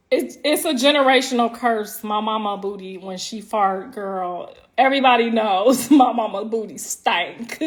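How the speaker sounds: usual speaking voice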